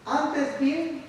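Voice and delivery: slow, solemn reading of the verse; scripture reading. Acted. Preacher reading Philippians to the congregation, slow and solemn. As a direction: read the apostle's words with weight.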